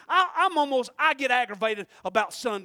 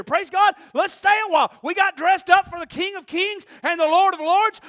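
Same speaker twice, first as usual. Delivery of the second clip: shouting, much higher and louder; preaching; 9 semitones higher, 16 dB louder than usual. Acted. Preacher urging the church to stay a while, shouting. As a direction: plead with them to stay.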